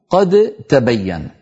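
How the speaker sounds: qad-tabayyan with an audible d in قد; unmerged dal. Shown as a demonstration. Teacher showing the faulty reading, clear d in قد. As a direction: sound the د in قد تبين as a separate d before the t. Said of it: "هذا لحن لغة".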